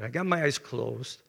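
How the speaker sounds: ordinary talk, nothing marked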